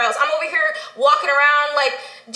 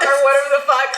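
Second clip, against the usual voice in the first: normal talking voice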